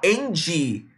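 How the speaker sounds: "Andy" slowly, with a Brazilian ee ending; added final vowel. Shown as a demonstration. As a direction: say "and" as "Andy", stretching the ee. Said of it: "você estende essa vogal". ("Andy" com i final esticado, à brasileira)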